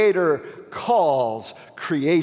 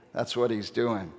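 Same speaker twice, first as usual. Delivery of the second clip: ordinary talk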